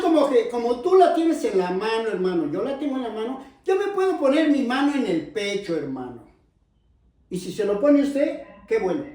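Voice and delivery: ordinary talk, nothing marked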